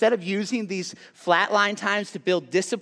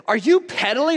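frustrated brother; 7 semitones higher, 4 dB louder than usual. Retold or imitated. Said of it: "so frustrated and upset".

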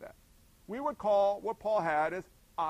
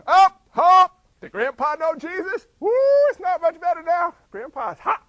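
mock outburst; 8 semitones higher, 12 dB louder than usual. Joking outburst at funeral.

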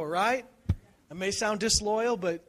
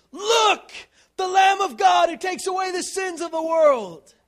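a high, loud shout pointing him out; yelling; 12 semitones higher, 13 dB louder than usual. Retold or imitated. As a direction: call it out at full voice like a wild man. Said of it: "He yells".

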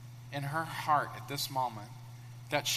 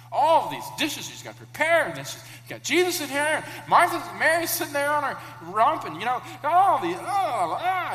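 higher, louder frantic rush; 9 semitones higher, 6 dB louder than usual. Acted. Preacher acting out Martha's frantic rush in the kitchen. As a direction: pile it on like a harried host.

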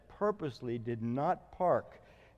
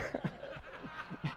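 1 breathy laugh; 12 semitones higher, 11 dB quieter than usual. Real, not acted. Laughing at own story.

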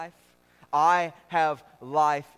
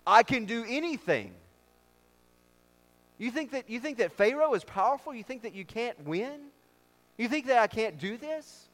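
lightly voiced God, pitched up; higher, incredulous rhetorical questions; God challenging doubters; 5 semitones higher than usual.

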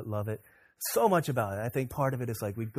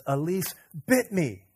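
put-on slow, higher hurt child voice; whimpering little boy; 4 semitones higher, 4 dB louder, slower than usual. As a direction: play the wounded little boy telling on his sister. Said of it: "He says".

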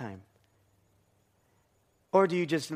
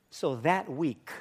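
ordinary talk

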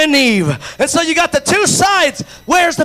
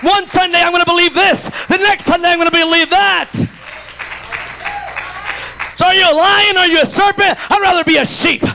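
preaching at full force; 4 semitones higher than usual. Real, not acted. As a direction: press each line home to the church.

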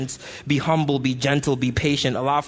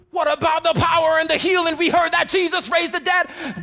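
eager crowd; 7 semitones higher than usual. Retold or imitated. Crowd demanding miracles from Paul.

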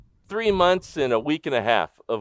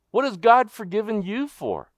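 much higher, pressing the question on them; pointed challenge; 9 semitones higher than usual. Shown as a demonstration. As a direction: throw it straight at the congregation. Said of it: "turns question on listeners".

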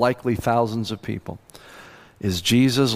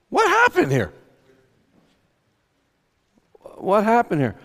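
baffled disbelief; 4 semitones higher than usual. Acted. Preacher voicing baffled losers.

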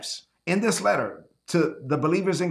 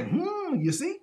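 high, soft superior hum; mocking a rule-loving churchgoer catching someone; smug told-you-so; 10 semitones higher, 4 dB quieter, slower than usual. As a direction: look down your nose and point it out. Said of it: "they like to say".

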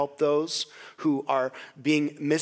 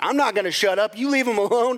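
higher-pitched argumentative retort; retold defiance; 5 semitones higher, 4 dB louder than usual. Churchgoer answering manager back in defence of the man, mild defiance. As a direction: stand your ground, acting out a quarrel.

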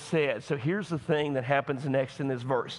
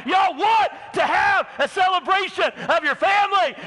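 much higher, full-force preaching shout; 14 semitones higher, 7 dB louder than usual. Real, not acted. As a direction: thunder it at the congregation. Preacher climaxes the rebuke.

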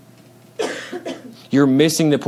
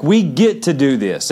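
normal talking voice

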